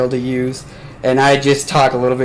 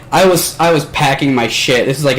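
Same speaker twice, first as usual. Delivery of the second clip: usual speaking voice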